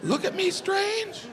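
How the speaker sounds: high-pitched, worried questioning; worried self-doubt. Retold or imitated. Frantic inner doubt about pronunciation.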